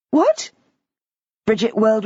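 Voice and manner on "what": high rising startled what; jolted, whip it up high. A narrator's reading. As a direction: Bridget startled by a sudden voice. Bridget whirls round startled.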